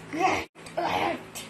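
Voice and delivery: little piggy grunts; piglet grunts. Acted. Piglets grunting along to the song, little grunts. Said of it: "piglets went grunt".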